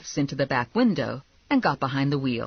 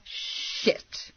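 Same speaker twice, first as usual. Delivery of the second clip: high, hushed, airy expletive; dismayed curse; 11 semitones higher, 6 dB quieter than usual. A narrator's reading. Bounty hunter watching own car being stolen, dismayed hushed curse. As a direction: curse softly under the breath.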